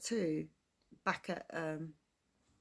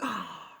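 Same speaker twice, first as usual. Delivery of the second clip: a short shocked gasp; surprised gasp. Shown as a demonstration. Explaining gobsmacked to Americans, a mock shocked gasp. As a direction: gasp as if struck speechless. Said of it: "you put your hand over your mouth and you're like".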